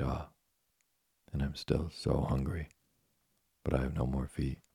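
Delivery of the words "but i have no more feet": ordinary delivery, nothing marked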